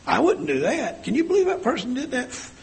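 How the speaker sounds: high, incredulous mock disapproval; mock-shocked gossip. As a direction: play the scandalised gossip. Acting out a judgemental gossip.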